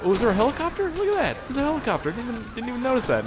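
high-pitched, wondering surprise; surprised. Real, not acted. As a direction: pipe up in amazed discovery. Feigned surprise at spotting helicopter.

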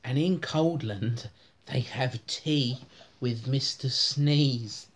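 ordinary delivery

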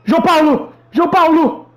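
calling out. Real, not acted. Calling out to friend.

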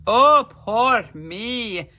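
very slow, loud self-pitying wail. A narrator's reading. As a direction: drag out the moan. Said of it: "wailed Jamie".

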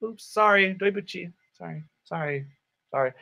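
put-on apologetic girl. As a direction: say sorry quickly and shyly, dying away. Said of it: "And she goes".

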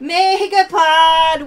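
sung-out, drawn-out hype call; live-stream host shouting the prize; excited shout. Real, not acted. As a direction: belt it out slow like a hype chant. Opening a Megapod live, hyped.

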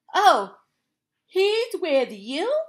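loud oh, then slow question; surprised realization. Acted. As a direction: dawn on them slowly. Mice realize.

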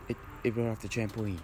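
ordinary talk, nothing marked

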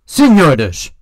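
senhores shouted high and loud, falling at the end; loud call. Shown as a demonstration. Lecturer shouting senhores to show volume differences. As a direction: bark senhores out to the room. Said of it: "variações do volume de sopro e da entonação".

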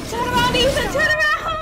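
high, loud urgent commands; yelling at the monster to turn away; panicked yelling. Real, not acted. Player begging Ethan to turn around, yelling.

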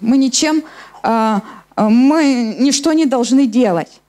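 normal talking voice

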